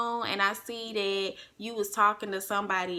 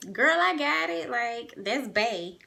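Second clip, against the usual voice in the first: brushing off mama; 3 semitones higher than usual. Retold or imitated. Daughter waving off mama's money worry, brushing her off.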